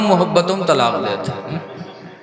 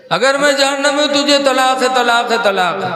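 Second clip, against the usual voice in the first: high, raised imitated outburst; mock angry husband; 7 semitones higher than usual. Preacher mimicking angry husbands, high mock outburst. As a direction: ham up the angry husband's threat.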